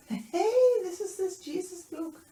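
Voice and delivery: high, loud mocking call; jeering soldier. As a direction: crow it out like a rowdy soldier. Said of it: "And the soldiers were".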